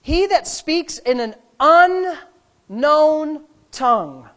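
preacher reading scripture slowly; slow emphatic reading. Real, not acted. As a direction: stretch every word of the verse. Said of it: "It says".

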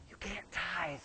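whispered temptation; whisper of money. Retold or imitated. Money whispering to the believer, tempting. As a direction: hiss it like a tempting voice.

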